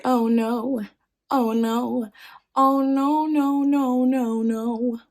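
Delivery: mock-dismayed, drawn-out realisation. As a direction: let the dread ring out on each no. Father realises he was tricked.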